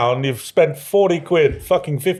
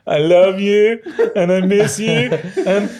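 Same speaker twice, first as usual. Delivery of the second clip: high, hammed-up laughing sob; mock crying meme; 10 semitones higher, 4 dB louder, slower than usual. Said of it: "When you were doing the crying".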